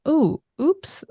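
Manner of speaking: high little "ooh, oops"; a light, playful uh-oh; mock oops. Voicing a gentle repair reaction.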